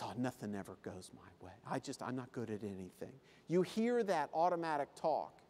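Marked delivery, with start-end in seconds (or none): 0.0-3.2 very low, soft, defeated mumble; hushed, hopeless self-pity; glum pessimist. Acted. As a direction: sigh it out in gloomy resignation. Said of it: "And they talk like this".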